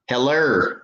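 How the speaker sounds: Heller said with a falling tune, HEL-er with an r ending; Bama slang. Retold or imitated. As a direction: imitate the Alabama greeting Heller. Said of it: "That's that Bama slang".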